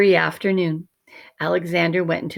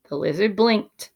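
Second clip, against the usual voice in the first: ordinary delivery, nothing marked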